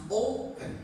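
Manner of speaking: "open" with a strong O; stress on first syllable. Shown as a demonstration. Teacher showing how we pronounce this one, "open" stressed on the O. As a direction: lean on the O of "open", light "pen". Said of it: "O is stronger".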